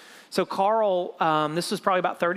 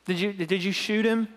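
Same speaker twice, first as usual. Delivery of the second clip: incredulous; 3 semitones higher than usual. Retold or imitated. Pastor repeats the question in disbelief.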